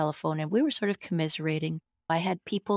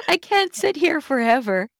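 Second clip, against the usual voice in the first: much higher, louder, with a laugh breaking through; laughing disbelief; 11 semitones higher, 5 dB louder than usual. Retold or imitated. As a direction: laugh at how silly it is. Laughing at her own dilemma.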